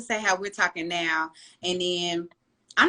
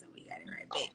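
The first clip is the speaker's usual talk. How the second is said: whispered aside; 18 dB quieter, faster than usual. Real, not acted. Closing a pointed remark.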